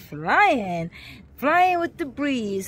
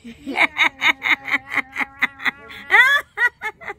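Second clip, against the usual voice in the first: laughing; 3 dB louder than usual. Real, not acted. Laughing after meows.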